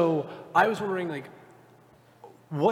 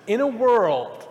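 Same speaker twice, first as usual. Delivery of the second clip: slow mock trailer intro; lightly hammed-up trailer narrator; movie trailer voice; 6 semitones higher, 4 dB louder, slower than usual. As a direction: draw it out like a trailer opener.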